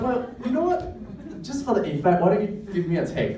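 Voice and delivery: high, quickening imitation of a director; casting director. Casting director asking actor for another take, high imitated voice. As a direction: pitch up and breezily push for another take. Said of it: "and then he said".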